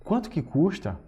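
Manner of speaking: QUANTO pitched high, falling through CUSTA; high, emphatic "Quanto" in a price question; intensified question intonation. Shown as a demonstration. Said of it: "faz isso com a entonação da voz".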